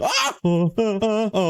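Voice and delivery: sudden high yelp; startled. Real, not acted. Gamer hit by the iron golem, startled yelp. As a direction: jump at the hit.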